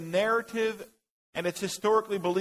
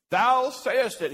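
slow, louder, higher British "Thou sayest it"; mock British accent; 5 semitones higher, 6 dB louder, slower than usual. Teacher jokes that Jesus spoke with a British accent. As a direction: ham up a British King James reading. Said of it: "he probably said it in a British accent".

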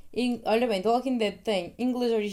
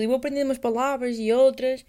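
normal talking voice

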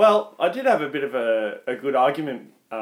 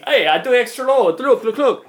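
high, loud broken-English impression; mock Vietnamese accent; 9 semitones higher, 6 dB louder than usual. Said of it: "trying to do the accent".

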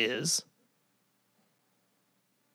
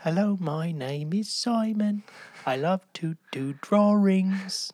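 put-on high, slow sketch-comedy kid voice; Simon; 6 semitones higher, slower than usual. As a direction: imitate a little boy showing off, drawn out and high. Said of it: "S N L sketch from the early nineties with Mike Myers".

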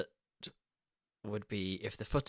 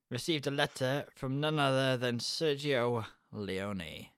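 usual speaking voice

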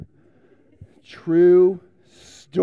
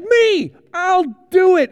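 much higher, eager call of me; eager volunteer; 11 semitones higher, 7 dB louder, slower than usual. Pretend. Pastor to kids, playing himself volunteering, eager high call. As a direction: shout it like the hand shot up first.

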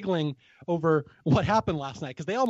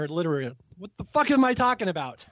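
ordinary delivery

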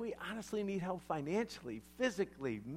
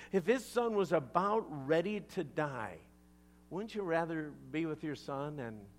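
ordinary talk, nothing marked

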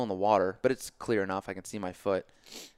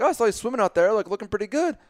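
high, loud friendly admiration; chatty passer-by; 12 semitones higher, 8 dB louder than usual. Retold or imitated. Dog walker praising the bleeding swimmer, cheerily oblivious. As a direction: imitate an oblivious stranger's compliment.

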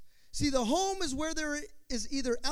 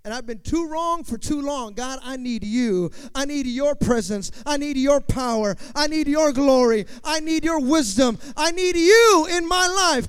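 praying louder and louder, climbing to a cry; loud prayer; 4 semitones higher, 13 dB louder than usual. Acted. Worshipper crying out to God, building to a loud plea. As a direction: cry out to God with rising force.

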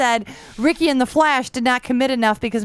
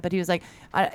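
ordinary delivery

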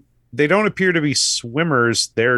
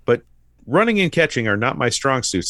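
ordinary delivery

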